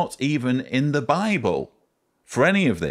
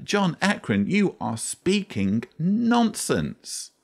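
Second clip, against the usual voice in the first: higher, slow, fed-up rebuke; exasperated scolding; 9 semitones higher than usual. Real, not acted. Scolding the presenter by name, higher and slow in exasperation. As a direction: drag it out in disbelief.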